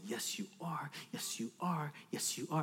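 soft, low repeated affirmation; father's reassurance. Retold or imitated. Dad answers his daughter, soft reassurance. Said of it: "And so I'll say".

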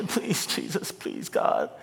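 soft, breathy begging, fading to a whisper; hushed mock prayer; mock pleading prayer. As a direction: plead quietly like a desperate worshipper. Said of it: "Moses is up here praying like".

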